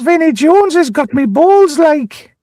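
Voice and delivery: high, hammed-up Geordie yelp; mock Geordie accent. Gazza grabbed by Vinnie Jones, high mock Geordie accent. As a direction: squeal it as a cartoonish Geordie. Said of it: "That sounds like Gaza".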